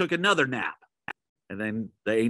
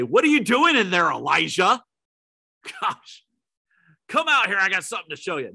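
high, loud, calling into the cave; exaggerated loud call out of the cave; booming mock summons; 7 semitones higher, 4 dB louder, faster than usual. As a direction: shout it like a hearty host calling out. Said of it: "the voice does not say".

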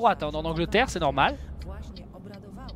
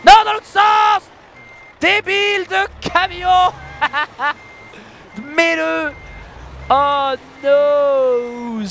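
annoyed yell; 7 semitones higher, 5 dB louder, much slower than usual. Real, not acted. Frustrated at the truck.

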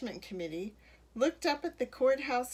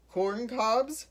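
louder, rising question; baffled mayor's question; puzzled echo; 6 dB louder than usual. A narrator's reading. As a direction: echo it back in disbelief.

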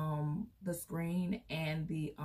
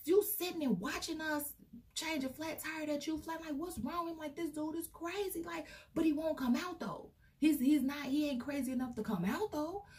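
high, frantic retold ranting; mock high-pitched yelling; 10 semitones higher than usual. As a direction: squeal it like an outraged boyfriend. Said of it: "screaming and hollering".

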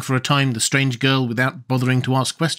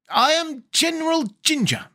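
put-on high, proud girl general's voice; lightly high-voiced young general; General Ginger. A narrator's reading. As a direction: announce yourself like a commander.

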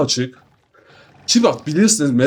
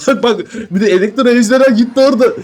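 laughing excitedly; 6 semitones higher, 4 dB louder than usual. Real, not acted. Electro dragon hits the bats.

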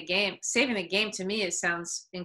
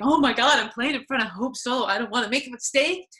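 higher-pitched mock panic; nervous; 5 semitones higher, 6 dB louder than usual. Nervous opponents fretting about facing the star goalkeeper, mock-panicked. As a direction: imitate jittery players dreading a slip. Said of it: "'Cause they're nervous. They're like".

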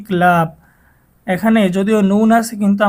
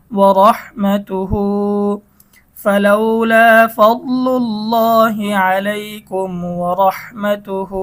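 slow chanted tilawat; Quran recitation; 3 dB louder, much slower than usual. Shown as a demonstration. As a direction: recite the verse smoothly. Recites the verse slowly. (ধীর তিলাওয়াত)

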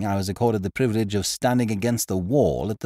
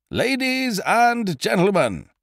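high, slow stage announcer shout over the crowd; announcer's call; 12 semitones higher, slower than usual. Acted. Compère calling to a restless music-hall crowd, high and drawn out. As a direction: call the house to order from the stage.

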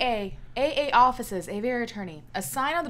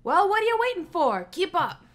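impatient guard; 4 semitones higher, 6 dB louder than usual. Acted. Guard voice reading dialogue.

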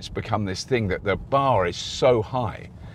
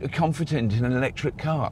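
higher, whining doubter; whiny voice; 7 semitones higher than usual. Retold or imitated. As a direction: whine it like a nervous buyer. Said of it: "I have to put on a whiny voice".

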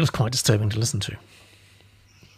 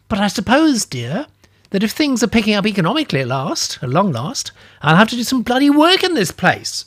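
huffing, higher at the end; huffy disapproval; 7 semitones higher, 4 dB louder than usual. Acted. Old lady grumbling she may have to work, huffy. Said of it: "she huffed in disapproval".

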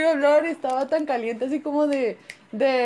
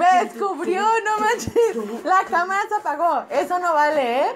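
higher-pitched, half-laughing complaint; whiny protest; 5 semitones higher, 3 dB louder than usual. Real, not acted. Prankster found out when the camera shut off, whiny laughing protest. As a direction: complain that it's unfair, laugh creeping in.

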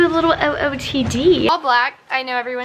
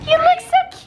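excited squeal. Real, not acted. Complimenting friends dressed for dinner, excited squeal.

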